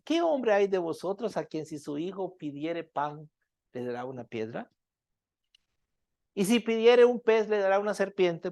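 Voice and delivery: soft, slowed reading of the Bible verse; scripture reading. Acted. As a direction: read the passage gently, lowering the voice. Reads a Bible passage aloud.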